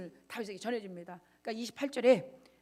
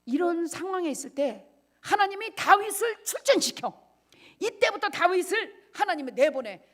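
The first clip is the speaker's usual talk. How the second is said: loud, high-pitched sermon cry; higher, louder preaching burst; full-force preaching; 7 semitones higher, 7 dB louder than usual. Acted. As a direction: proclaim it to the whole hall.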